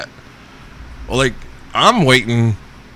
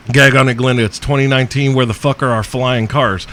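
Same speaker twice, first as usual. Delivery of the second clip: ordinary talk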